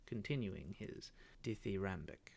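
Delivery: plain reading voice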